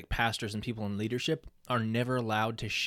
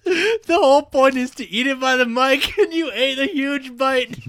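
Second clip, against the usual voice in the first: laughing; 11 dB louder than usual. Real, not acted. Talking through laughter, high.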